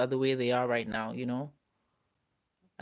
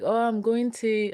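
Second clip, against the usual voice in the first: higher, slower Scottish lilt; Scottish accent; 7 semitones higher, slower than usual. Retold or imitated. Recalling childhood friends talking about their plans, Scottish accent. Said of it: "I'm gonna do my Scottish accent for you".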